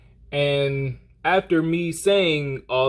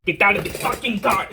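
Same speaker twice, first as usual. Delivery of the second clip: high, heated demand in a mock Arabian accent; put-on Arabian accent; 7 semitones higher than usual. Driver demands he get out, worked-up mock Arabian accent. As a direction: imitate an Arabian man losing it, pitch jumping up. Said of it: "I need to stop doing the accent".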